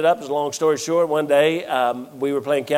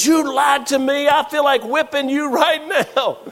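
mother confronting her grown son, mildly mad; mom, mad; 11 semitones higher than usual. Retold or imitated. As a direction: play his upset mother with the pitch up. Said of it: "and she was mad".

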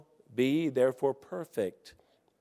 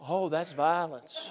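mock critic; 4 dB quieter, slower than usual. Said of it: "I know you're out there".

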